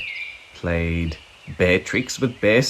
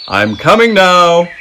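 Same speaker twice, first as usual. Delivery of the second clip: much louder call across the woods; 8 semitones higher, 9 dB louder than usual. A narrator's reading. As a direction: call out to find the hiding cub. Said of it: "Big Bear called".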